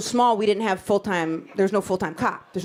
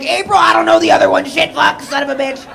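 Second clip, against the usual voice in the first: loud flustered cursing; mock panicked dad; 5 semitones higher, 9 dB louder than usual. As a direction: blurt it like a dad caught out.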